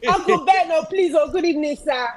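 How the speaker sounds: Oyinbo accent. Real, not acted. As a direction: greet politely in a Western-tinged Oyinbo accent. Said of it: "Oyinbo accent".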